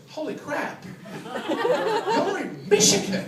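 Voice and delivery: high, drawn-out astonished cry; mock amazement. Drive-thru worker to lost Australians, mock amazement. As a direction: build from startled to loud disbelief.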